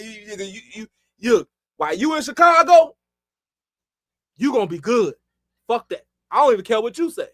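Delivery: lightly acted stubborn insistence; aggressive cousin. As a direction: imitate a cousin overruling you, stumbling into it. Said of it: "My cousin was aggressive as hell".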